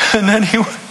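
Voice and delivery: high, strained, half-laughing as if tearing up; choked-up reading. Retold or imitated. Pastor reading movie end text to his wife, choking up while laughing. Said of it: "I was weeping like a baby".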